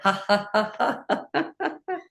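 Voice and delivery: rising bubbly laughter; demonstrated laughter. Shown as a demonstration. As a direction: laugh that climbs high. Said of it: "you can laugh along with it".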